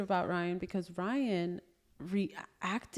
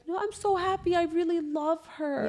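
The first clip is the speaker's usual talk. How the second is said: high, mock-tearful gushing; put-on high voice, drawing out the end; imitated happy crying; 9 semitones higher than usual. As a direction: imitate an overjoyed child through tears. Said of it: "She started crying".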